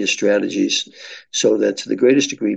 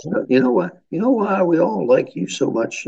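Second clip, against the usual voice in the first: put-on higher voice with a light Southern accent; Sweet Alice; 9 semitones higher than usual. Community leader saying goodbye to police chief, light Southern accent. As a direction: lightly imitate an older Southern woman's accent. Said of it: "she had this delightful Southern accent".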